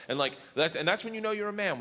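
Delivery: ordinary talk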